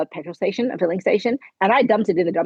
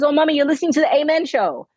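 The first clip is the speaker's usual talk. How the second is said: put-on higher, quick little-girl chirp; daughter's voice; 8 semitones higher, faster than usual. Daughter spotting mom's podcast, higher quick little-girl voice. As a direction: play the excited young daughter, quick and bright. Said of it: "she goes".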